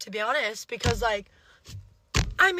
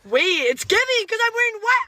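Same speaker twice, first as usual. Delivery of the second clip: excited; 8 semitones higher, 9 dB louder than usual. Real, not acted. Excited joke about bride.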